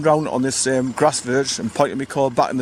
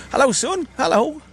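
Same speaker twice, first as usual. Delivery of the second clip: high-pitched doggy-greeting voice; real, playful pet talk; 12 semitones higher than usual. Real, not acted. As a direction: coo a hello to your dog, way up high. Greeting his dog in the car.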